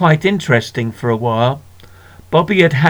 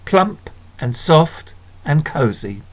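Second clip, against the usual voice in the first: ordinary delivery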